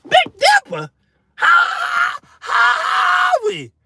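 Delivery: high shrieking disbelief; comic panic. Retold or imitated. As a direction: scream it in mock horror.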